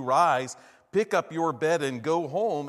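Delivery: loud command to rise; commanding shout. Retold or imitated. Jesus orders the paralytic to rise, in a loud commanding call. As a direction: call out with full authority.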